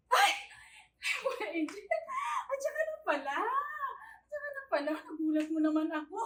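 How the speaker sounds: loud startled yelp, jumping high. Acted. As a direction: blurt it out, caught off guard. Yaya startled by returning charge. (gulat na gulat)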